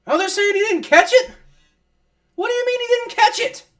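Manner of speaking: high, loud incredulous outburst; can't believe the ruling; disbelief. Real, not acted. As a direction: fan shouting in shock at the call. Outraged fan at refs' call.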